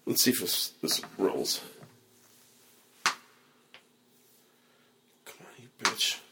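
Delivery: muttered low to himself; real muttering while the clip won't load; under his breath. Real, not acted. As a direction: grumble at the stalling clip under your breath. Fighting to play a clip.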